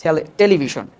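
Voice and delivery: "টেলিভিশন" ending in sh, te-li-vi-shon; Bengali-style -shon in "টেলিভিশন"; common pronunciation. Shown as a demonstration. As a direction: say "টেলিভিশন" the everyday way with sh. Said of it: "আমরা সচরাচর বলি".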